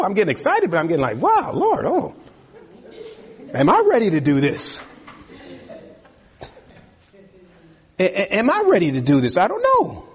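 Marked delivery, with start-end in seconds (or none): 1.2-10.1 awed hesitation. Retold or imitated. Voicing his own awed hesitation.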